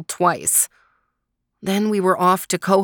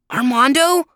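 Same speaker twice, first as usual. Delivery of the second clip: higher, louder questioning call; calling a name; 7 semitones higher, 5 dB louder than usual. A narrator's reading. Desmond to his sleeping brother, calling him awake. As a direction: call out to someone asleep.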